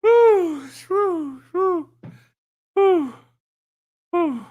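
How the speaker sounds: string of high-pitched whews; real winded whooping exhale. Real, not acted. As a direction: blow out high whews to recover from laughing. Recovering from hard laughing.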